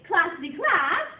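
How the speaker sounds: loud, sing-song call; teacher's playful call variation. Shown as a demonstration. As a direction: bounce it out playfully to the class. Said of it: "So, if I say".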